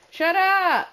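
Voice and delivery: high, loud shout; real yell. Real, not acted. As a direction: yell it sharply across the house. Yells to interrupt household noise.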